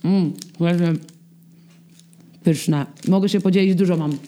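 talking while chewing cake; mouth full. Real, not acted. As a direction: speak round a mouthful of cake. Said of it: "jak ona to je".

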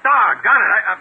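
high, loud and rushed, rattled; scrambling and flustered in the dark. Acted. Inspector fumbles for his lost flashlight, flustered and loud.

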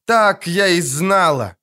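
high, drawn-out indignant cry; siren's high-pitched outburst; indignant squeal. A narrator's reading. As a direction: squeal it out in outrage. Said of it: "взвизгнула сирена".

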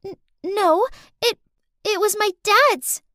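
put-on high-pitched, halting child voice; nervous stutter. A narrator's reading. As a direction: stammer like a little girl caught out. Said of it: "She turned pale and stuttered".

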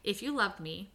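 usual speaking voice

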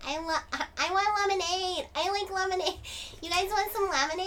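put-on high-pitched small child; little girl voice. Little girl selling lemonade on the street, high child voice. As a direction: pipe up like a kid at a stand. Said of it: "Like a little girl".

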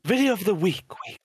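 segment jingle echo; announcer voice. Pretend. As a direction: hype it like a show announcer.

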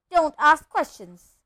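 loud, slow sharp snap; snapping. Acted. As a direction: bite off the words. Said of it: "snapped his uncle".

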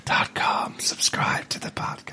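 slow, soft voiceless whispered plug; joking spooky whisper; mock whisper. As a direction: breathe the sign-off like a spooky voice. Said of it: "whispering never comes across".

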